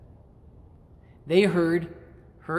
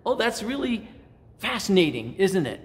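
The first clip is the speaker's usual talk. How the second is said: put-on higher-pitched, charmed reaction to an accent; charmed interest; 5 semitones higher than usual. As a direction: sound politely fascinated, pitch lifted. Delighted reaction to British accent.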